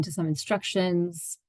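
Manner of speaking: unhurried meditation guide, long pauses between phrases; guided meditation. Acted. Therapist guiding listeners into their own space, meditative and unhurried. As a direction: ease listeners into stillness, leaving space after each phrase.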